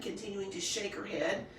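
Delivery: plain reading voice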